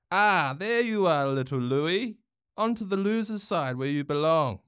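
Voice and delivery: a smug bully's sing-song jeer; smirking. A narrator's reading. Bronx sends little Louis to the losing side, smirking. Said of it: "Bronx said with a smirk".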